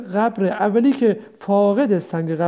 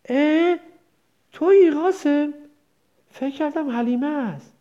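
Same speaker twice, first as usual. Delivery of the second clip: a lightly voiced mother's mild surprise, higher; mild surprised recognition; 6 semitones higher than usual. A narrator's reading. Mother suddenly notices her son, mildly surprised. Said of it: "شگفت زده گفت".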